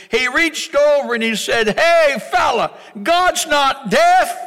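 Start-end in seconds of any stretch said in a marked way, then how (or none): 1.8-4.4 high, loud, slow irritated jab; irritated rebuke. Retold or imitated. An irritated brother rebuking a loud worshipper, high and loud. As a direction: snap at the praying brother next to you. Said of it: "He was irritated".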